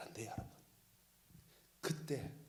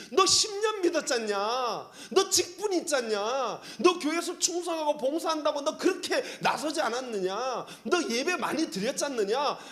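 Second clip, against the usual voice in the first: rebuke; 7 semitones higher, 7 dB louder than usual. Pretend. Preacher voicing rebuke of believers.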